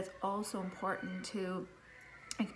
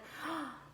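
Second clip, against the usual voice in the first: sharp startled gasp, pitched up; sudden gasp; 8 semitones higher, 5 dB quieter than usual. Retold or imitated. Mom recalling the sudden fear for her kids, startled gasp. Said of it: "And then I'm like".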